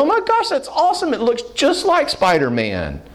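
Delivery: high, amazed parent voice; gushing praise. Acted. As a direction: gush over a kid's drawing. Parent gushing over a child's drawing.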